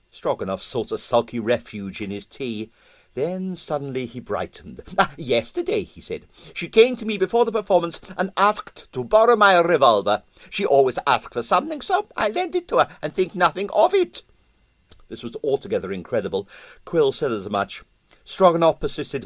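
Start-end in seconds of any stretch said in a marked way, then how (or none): 5.0-14.2 put-on higher, Russian-accented, musing aloud and pleased with himself; pompous Russian impresario. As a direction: talk it through like a vain theorist. Stroganoff voiced by narrator.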